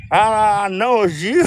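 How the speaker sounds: high accusing mock voice; imitating Linda. Linda accusing the speaker, in a high imitated voice. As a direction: play Linda pinning it on him, high. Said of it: "she goes".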